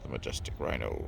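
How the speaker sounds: low, hushed wildlife narrator; nature documentary. Pretend. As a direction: murmur it like a wildlife film. Mock documentary about rhino.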